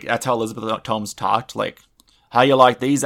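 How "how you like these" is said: put-on Boston accent. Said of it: "I can't do a Boston accent".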